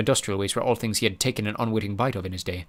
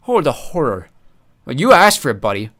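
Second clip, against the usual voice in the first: sarcastic; 5 semitones higher, 8 dB louder than usual. Acted. Mulch mocking fear.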